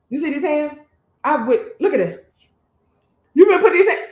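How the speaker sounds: jokingly loud warning, building as it goes; mock threat. Playful mock threat with raised hands.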